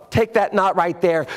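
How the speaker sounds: ordinary talk, nothing marked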